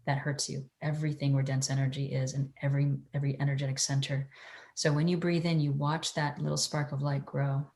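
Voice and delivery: soft, hushed meditation guide; airy breathy mystical healer. Acted.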